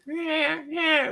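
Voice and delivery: squeaky high cat answering, very high. Retold or imitated. Cat answering the coaxing daughter, squeaky high. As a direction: pitch it way up like a cat. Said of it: "the cat's like".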